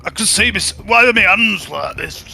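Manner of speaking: bewildered Yorkshireman waking in the wrong body; Yorkshire accent, lost and panicky. Acted. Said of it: "I'm gonna try and do that with a Yorkshire accent".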